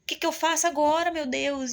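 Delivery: high, strained pleading to mother; desperate plea. Retold or imitated. Daughter at her limit begging her mother, desperate high plea.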